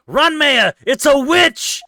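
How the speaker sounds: high, loud panicked scream. Acted. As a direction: shriek a warning, laughing. Laughing scream about witch.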